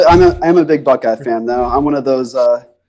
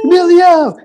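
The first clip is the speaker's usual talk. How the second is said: mock call-out; 5 dB louder than usual. Echoing the meme call.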